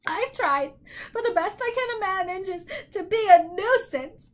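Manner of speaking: high, wailing bird voice; tearful bird. A narrator's reading. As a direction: lament loudly through bird tears. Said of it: "filthy tears rolled down his beak".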